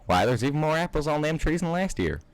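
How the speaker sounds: put-on high Southern farm girl voice; Applejack's high Southern twang; Southern accent. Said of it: "I'm gonna stop this accent".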